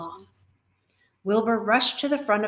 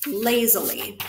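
ordinary delivery

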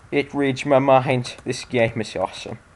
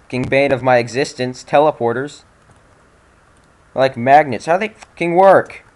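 higher and louder, building to a yell; exasperated rant; 4 semitones higher, 7 dB louder than usual. Real, not acted. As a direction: rant at the puzzle in disbelief. Ranting at teleporters.

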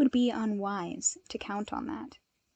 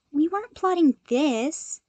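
higher, whiny petulant protest; 7 semitones higher, 6 dB louder than usual. A narrator's reading. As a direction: sulk and protest like a scolded kid. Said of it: "Izuku said petulantly".

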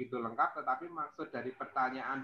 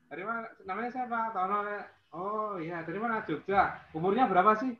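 higher, quick prying questions; mock nosy stranger; 7 semitones higher than usual. Shown as a demonstration. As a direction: act out rudely nosy small talk. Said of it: "Gitu kan nggak nyaman juga".

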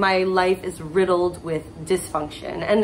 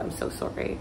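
ordinary delivery

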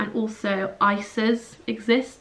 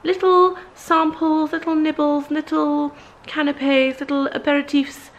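mock dainty; 5 semitones higher than usual. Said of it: "I thought".

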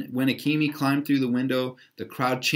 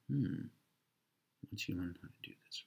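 quiet, fast mutter under the breath; puzzling over the clue half aloud; muttered to self; 4 semitones lower, 13 dB quieter than usual. Real, not acted. Reader mutters the riddle to himself, quiet and fast.

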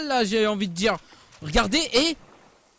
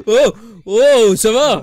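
louder, through laughter; laughing taunt; 8 dB louder, much slower than usual. Real, not acted. Streamer laughing at a beaten opponent, mocking. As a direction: laugh while mocking the loser.

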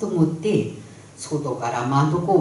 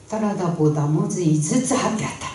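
ordinary delivery

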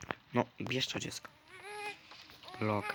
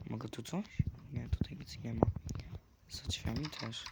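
whisper; 9 dB quieter than usual. Real, not acted. Whispering while exploring.

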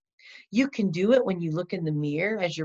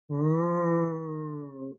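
R sound demo; 2 semitones lower than usual. Shown as a demonstration. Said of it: "Watch me".

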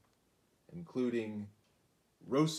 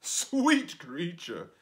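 slow, louder honeyed spider; flattering spider; 6 semitones higher, 8 dB louder, much slower than usual. Acted. Spider flattering the fly, slow honeyed voice. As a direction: draw out the sweet talk. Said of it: "said the spider".

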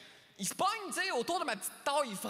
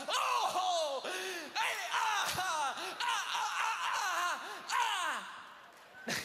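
fake joyful laughter; 4 semitones higher, much slower than usual. Feigning joy on jet ski.

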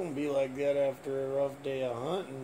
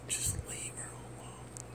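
whispering; 10 dB quieter than usual. Real, not acted. Hushed aside to filmer.

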